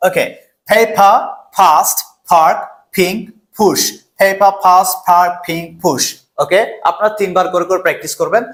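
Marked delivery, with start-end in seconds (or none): 0.7-3.8 পেপার, ফাস্ট, পার্ক, পিঙ্ক, পুশ with a puffed P; aspirated P drill. Shown as a demonstration. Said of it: "পি কে আমরা উচ্চারণ করব ফ". 4.2-6.0 পেপার, ফাস্ট, পার্ক, পিঙ্ক, পুশ with a puffed P; aspirated P drill. Shown as a demonstration. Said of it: "পি কে আমরা উচ্চারণ করব ফ".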